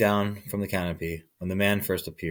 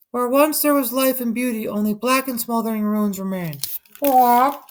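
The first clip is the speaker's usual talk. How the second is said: toucan voice. A narrator's reading. Toucan warning the man, high squawky. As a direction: keep squawking between warnings.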